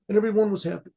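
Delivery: usual speaking voice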